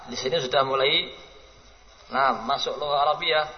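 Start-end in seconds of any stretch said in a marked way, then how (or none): none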